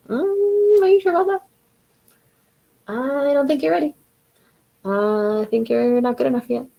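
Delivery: gremlin voice. Acted. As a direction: squeak out the doubts like a nagging gremlin, easing down by the end. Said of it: "your gremlin's in there with you going".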